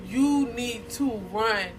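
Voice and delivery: slow, weighted "You need to run"; emphatic warning. Retold or imitated. Friend on the phone warning her to leave, emphatic. Friend urgently advising escape.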